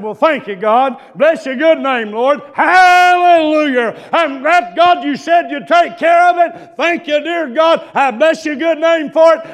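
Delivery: carried-away shouting prayer, high and very loud; full-voiced revival praise, reenacted; hollered praise. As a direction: holler thanks to God with long-held words. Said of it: "you was hollering about in there".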